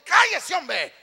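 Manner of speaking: high, loud burst of annoyance; acted scolding shout. Annoyed churchgoer silencing a joyful brother, mock scolding shout. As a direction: cut the worshipper off with a yell.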